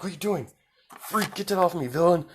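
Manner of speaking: high-pitched mock protest; lightly acted alarmed outrage, voice jumping up; retold outcry. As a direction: imitate a kid yelping at an attacker. Acted protest during retold fight.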